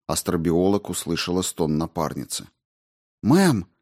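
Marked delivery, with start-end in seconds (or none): 3.2-3.7 high, urgent call. A narrator's reading. Rao hears her partner moan, calls out to her. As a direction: call out sharply to get her attention. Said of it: "окликнула она её".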